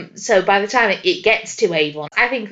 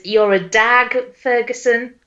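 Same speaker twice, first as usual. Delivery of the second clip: slow, drawn-out Australian put-down; half-joking Aussie put-down to Miss Ferguson; mock Aussie accent. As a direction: lay on a broad Aussie accent, slowly. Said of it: "He doesn't have that accent at all".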